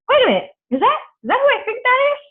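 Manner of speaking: high, loud incredulous double take; startled disbelief. Retold or imitated. Acts out her startled double take.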